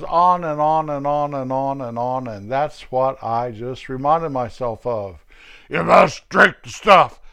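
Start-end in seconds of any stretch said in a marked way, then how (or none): 5.7-7.2 bossy nurse. Retold or imitated. Acted quarrel, nurse versus patient.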